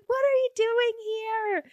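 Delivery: very high incredulous squeal; imitated high-voiced disbelief; mock outraged shock. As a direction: act out her shocked, high-pitched question. Retold shock at finding him there.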